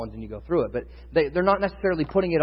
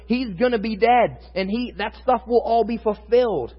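higher, louder, driving sermon delivery; preaching at full force; 7 semitones higher, 4 dB louder than usual. Acted. Pastor urging his congregation about the Passover, fervent preaching. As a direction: build the fire of the message.

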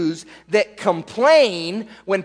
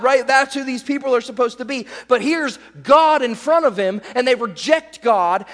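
ordinary talk

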